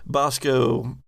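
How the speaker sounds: normal talking voice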